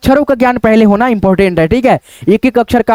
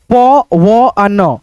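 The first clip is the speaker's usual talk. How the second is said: each letter stretched, pauses between; teacher dictating letters; slow spelling out; 2 semitones lower, much slower than usual. Shown as a demonstration. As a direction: sound out every letter very slowly. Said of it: "teacher spells out letters".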